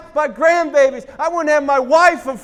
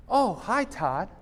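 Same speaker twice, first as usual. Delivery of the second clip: a hint of a soft girl's voice, lower; quiet shy reply on the phone; [name] answering the phone, lightly voiced; 6 semitones lower, 9 dB quieter, slower than usual.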